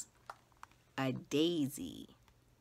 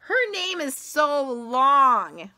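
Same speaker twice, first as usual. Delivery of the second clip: higher, louder, slow sneer; mocking schoolgirl; 9 semitones higher, 11 dB louder, slower than usual. Jo mocking Chrysanthemum's name, slow and loud. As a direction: drag it out to tease. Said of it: "said Jo".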